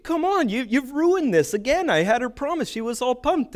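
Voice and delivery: high-pitched whiny protest; exasperated complaint. Retold or imitated. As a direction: raise the pitch, plead in disbelief. Complaining to hockey organizer.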